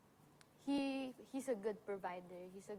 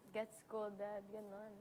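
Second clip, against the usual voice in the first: normal talking voice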